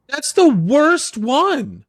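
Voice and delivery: high, loud, drawn-out objection; outraged protest. Real, not acted. Co-host rejecting a rom-com pick, loudly outraged. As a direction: cry out in disbelief, stretching every word.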